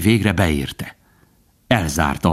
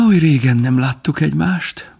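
sighing; 8 semitones higher than usual. A narrator's reading. Faline sighing to Bambi after years apart. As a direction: let it out like a sigh of lost time. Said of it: "Faline sóhajtott".

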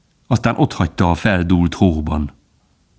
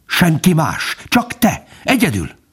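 high, scornful insistence; hissed accusation; 10 semitones higher than usual. A narrator's reading. Fox singling out the dog, high scornful accusation. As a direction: press the blame home, pitch raised. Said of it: "sziszegte a róka".

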